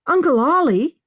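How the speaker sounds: high, loud burst of surprise; amazed to hear from a missing uncle; startled exclamation. A narrator's reading. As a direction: exclaim the name, voice leaping up. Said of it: "she exclaimed".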